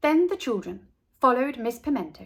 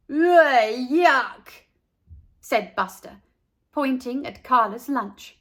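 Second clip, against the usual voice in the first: louder, grossed-out jeer; mocking disgust; 3 semitones higher, 6 dB louder, slower than usual. A narrator's reading. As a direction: tease her lunch loudly. Buster mocking Carla's lunch.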